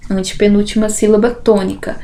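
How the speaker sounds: ordinary talk, nothing marked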